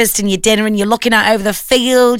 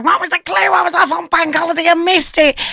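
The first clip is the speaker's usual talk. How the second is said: listener complaining; 7 semitones higher than usual. Retold or imitated. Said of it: "a load of people going".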